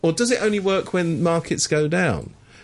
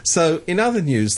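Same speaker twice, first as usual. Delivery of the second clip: ordinary talk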